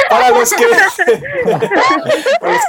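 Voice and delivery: breaking into laughter mid-sentence; giggling through the words; laughing. Real, not acted. Host cracks up mid-sentence. (riéndose a carcajadas)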